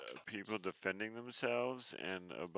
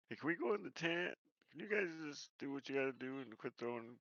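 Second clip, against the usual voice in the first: higher, pushy adventurer voice; character voice; 7 semitones higher than usual. Acted. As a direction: boss the party around in character. Player voices character, raised pitch.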